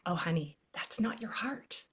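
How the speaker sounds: hushed, tender whisper; mother whispering comfort to her scared son; soft whisper. Retold or imitated. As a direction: whisper it to soothe a frightened child. Calming scared son at bedtime.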